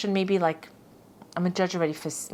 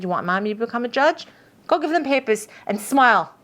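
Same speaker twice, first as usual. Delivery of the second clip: higher, louder motherly push to smile; mock mom scolding; 5 semitones higher, 6 dB louder than usual. Mother urging her kids to smile at voters, loud mock scold. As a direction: boss the kids along, half-joking but loud.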